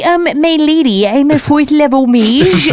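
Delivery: a shy gamer courting a lady; nerdy dude. Retold or imitated. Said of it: "a dude being like".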